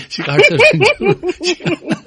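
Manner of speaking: laughing high through the words. Real, not acted. Host laughing at the guest's story, laughing high. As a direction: breaks into a laugh mid-sentence.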